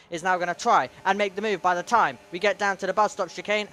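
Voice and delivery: usual speaking voice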